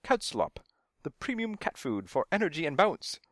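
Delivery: high-pitched mock commercial read; lightly voiced ad announcer. A narrator's reading. Stunt cat quotes the commercial, as a light announcer. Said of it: "The announcer says".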